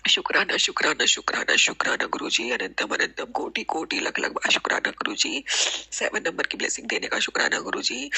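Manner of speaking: whispered thanks through tears; tearful thanks. Real, not acted. Crying devotee thanking guru in whispers. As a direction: let the thanks come out in a teary hush. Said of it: "मेरे आंसू निकल रहे हैं".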